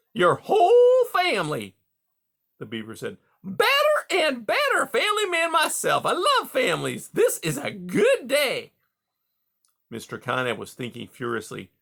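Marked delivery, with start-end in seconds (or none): 0.1-1.8 glowing beaver. A narrator's reading. Beaver delighted by Rye's answer, high and glowing. 3.4-8.7 glowing beaver. A narrator's reading. Beaver delighted by Rye's answer, high and glowing.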